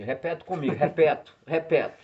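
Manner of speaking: REPETO, ending in O instead of E; imitated mispronunciation. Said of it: "que trocava o O, né, pelo E".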